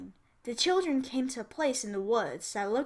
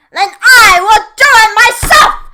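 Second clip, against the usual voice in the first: very high, loud furious witch shout; 11 semitones higher, 23 dB louder than usual. A narrator's reading. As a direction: yell it and shove her aside. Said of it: "shouted the witch".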